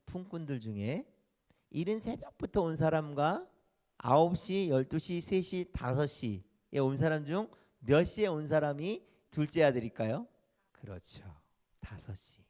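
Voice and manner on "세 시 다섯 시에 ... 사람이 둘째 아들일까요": usual speaking voice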